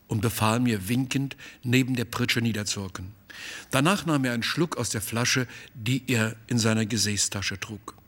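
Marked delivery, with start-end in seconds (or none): none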